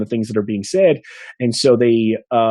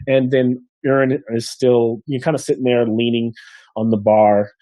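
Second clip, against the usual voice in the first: normal talking voice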